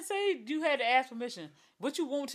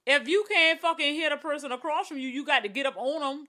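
louder, higher, irritated comeback; argumentative; 4 semitones higher, 4 dB louder than usual. Acted. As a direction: argue back forcefully with raised pitch. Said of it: "felt like an argument was ensuing".

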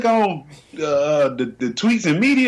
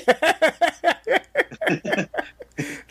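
high laugh; 10 semitones higher than usual. Real, not acted. Laughs at own joke.

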